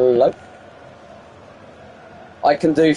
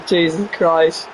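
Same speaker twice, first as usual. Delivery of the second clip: ordinary talk, nothing marked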